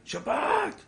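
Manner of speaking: high mock yell of שבת; retold shout. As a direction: call out שבת in sudden alarm. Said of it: "צועקים לו".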